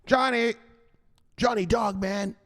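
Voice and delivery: high, called-out buddy voice; buddy calling out. Retold or imitated. Buddy hailing Johnny, high calling voice. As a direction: call his name like a pal hailing him. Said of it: "Here's your buddy right here".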